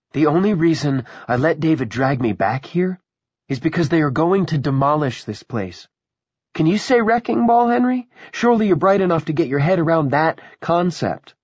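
high, loud, scornful challenge; taunting woman. Acted. Willa to Henry about the coming demolition, taunting. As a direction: lecture him loudly, pitched well up, with mounting scorn.

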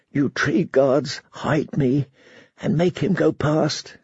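slow, soft prayer. Acted. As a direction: pray softly and slowly. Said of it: "she prayed".

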